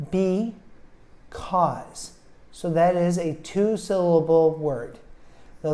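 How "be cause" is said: BE, CAUSE as two separate beats; split into syllables. Shown as a demonstration. Teacher showing two different vowel sounds, BE, CAUSE split apart. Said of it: "we hear two different vowel sounds".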